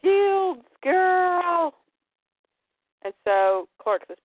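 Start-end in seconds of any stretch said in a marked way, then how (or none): 0.0-1.7 high, strained creature cry; monster cry. Acted. The Parademons calling out, high and crying. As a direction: wail the order. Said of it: "they go".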